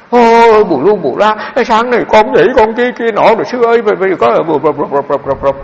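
high, loud mock blubbering, words dissolving into sobs; imitating crying woman. The weeping laywoman pouring out her grief to the monk, high and loud mock sobbing. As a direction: ham up a woman sobbing to the monk, half-joking. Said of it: "Bà đứng bà khóc miết vậy à".

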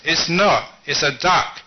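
wife loudly arguing back; acted quarrel, loud and insistent. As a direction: raise your voice to contradict him. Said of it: "the wife raised her voice".

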